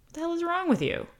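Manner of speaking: high, fast mock reproach; high incredulous jab; mock scolding. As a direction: scoff at him like a macho critic. Mock scolding of sensitive men.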